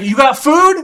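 high, loud question across the water; ranger yelling. Retold or imitated. Ranger shouts across the river to the hiker.

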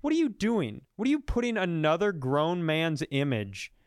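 ordinary talk, nothing marked

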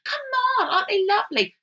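high, gushing proud-parent voice; doting parent. Retold or imitated. Mocking parents showing off their child, high gushing coo. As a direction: coo over the child like it's a miracle.

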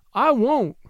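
high, understated tearful promise; mild acted sob. A narrator's reading. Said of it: "sobbed Submit".

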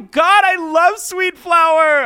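high, loud, over-the-top gushing; gushing shout. Acted. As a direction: belt it like a thrilled fan. Mock review read, shouted.

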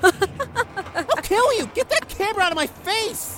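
loud, enraged yelling; furious shout. Acted. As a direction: scream it in a rage. Angry friend lashes out.

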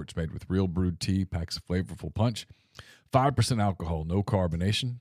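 upbeat sponsor read. Acted. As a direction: sell it like a radio ad. Reads a sponsor ad.

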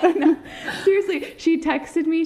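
laughing high and loud. Real, not acted. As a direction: giggle through the words. Laughing, tipsy chat.

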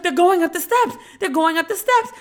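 high, loud panicked mom; imitating mom's alarm. Comic imitating his mom yelling at the TV, high and loud. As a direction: shout it like a frantic mother. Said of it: "And she was like".

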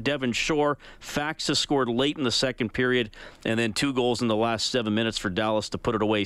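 ordinary talk, nothing marked

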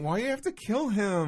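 put-on high, whiny teen complaint. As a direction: whine it like a sulky teen. Mocking the singer's whine.

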